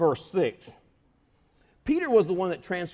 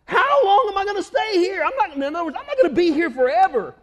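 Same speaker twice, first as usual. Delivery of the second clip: exasperated Jesus; 4 semitones higher than usual. Retold or imitated. Jesus fed up with his followers, exasperated. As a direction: vent that you're done with them. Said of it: "He says".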